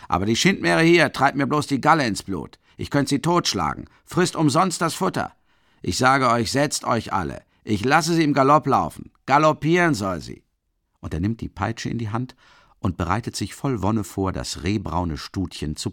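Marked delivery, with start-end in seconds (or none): 0.1-10.4 loud, high angry boasting; drunken ranting. A narrator's reading. Drunk Mikolka boasting to the crowd about his nag, loud and angry. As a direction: rant at the old nag.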